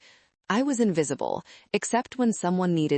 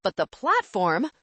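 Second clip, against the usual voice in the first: agitated insistence; 8 semitones higher than usual. A narrator's reading. Brad defends himself, agitated.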